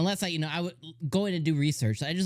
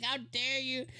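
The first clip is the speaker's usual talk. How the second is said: high, softer imitated indignation; retold protest through laughter; laughing mock outrage; 10 semitones higher, 6 dB quieter than usual. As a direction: play offended while giggling.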